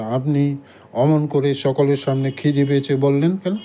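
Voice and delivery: ordinary delivery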